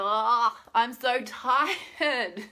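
loud "ah" then a long whiny moan; exaggerated weary whine; groaning, fed up. Shown as a demonstration. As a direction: groan and stretch "tired" right out. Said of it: "get into this like".

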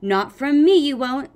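hammed-up defiant retort; bristling. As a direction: stand up to him, bristling. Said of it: "Sunny said, bristling".

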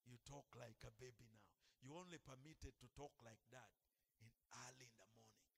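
ordinary talk

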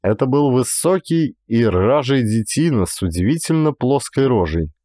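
ordinary delivery, nothing marked

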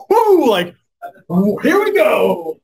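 high, loud whoop of joy; excited whoop. Retold or imitated. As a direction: cheer it out like you just got paid. First paycheck excitement.

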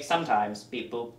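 lightly voiced robot, low and soft; understated deadpan machine voice; robot impression. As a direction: do a half-hearted comic robot. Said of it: "That was my robot impression".

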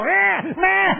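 high bleating lamb cry; imitated lamb bleat.

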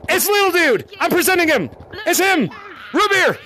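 high, excited call to the crowd; excited presenting. Real, not acted. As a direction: present the star guest excitedly.

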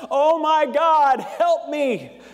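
high, loud drawn-out cry of mock panic; joke-teller's hammed-up plea to heaven; crying out for help. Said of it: "he cried out".

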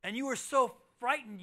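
normal talking voice

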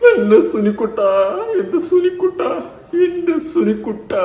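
high-pitched, hammed-up crying call; mock wailing. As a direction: wail the pet name as if sobbing, drawn out at the end. Mocks a woman's tearful calling. (കളിയാക്കിയുള്ള കരച്ചിൽ)

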